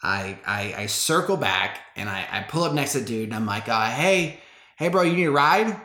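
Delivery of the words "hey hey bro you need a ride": re-enacted hail to a stranger, higher pitch; quick, casual shout-out from a car; lightly acted roadside offer; calling out.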